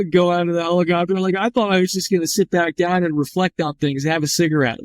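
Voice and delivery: high, amused sarcastic retort. Retold or imitated. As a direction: roll your eyes at the obvious. Sarcastic inner reply, laughing.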